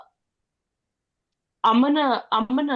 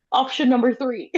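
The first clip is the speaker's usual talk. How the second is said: ordinary talk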